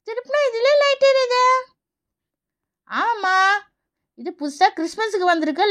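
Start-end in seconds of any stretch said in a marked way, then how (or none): 0.1-1.4 put-on delighted child. Child discovers the toy tree lights up, delighted. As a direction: marvel in a high little voice.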